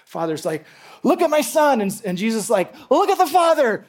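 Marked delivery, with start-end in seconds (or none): 1.0-1.8 high, loud burst of admiration; proud exclamation. Acted. Proud Father showing off Son. 2.9-3.8 high, loud, excited shout-out; admiring exclamation. Acted. Jesus exalting the Father.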